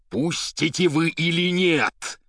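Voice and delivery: loud, high, slow threatening yell; terrible shout. A narrator's reading. Poet demands orderlies let him out, terrible shout. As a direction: roar each word at the orderlies. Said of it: "страшным голосом крикнул поэт".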